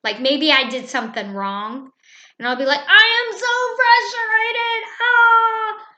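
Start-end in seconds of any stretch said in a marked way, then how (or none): 2.9-5.9 much higher, louder hammed-up frustrated outburst ending in a cry; faked frustration. Teacher modelling frustration for her class, mock exasperated outburst. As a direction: put on a big show of being fed up. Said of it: "So I will purposely get frustrated".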